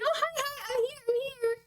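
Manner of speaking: high squeaky excited dog voice; high-pitched giddy dog voice; voicing the excited dog.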